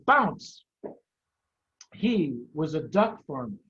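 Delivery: put-on lively, expressive read-aloud to children; story narration. A narrator's reading. Teacher reading a chapter book to kids, expressive storytelling. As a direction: tell the tale with relish, drawing out key words. Reads Fantastic Mr Fox aloud.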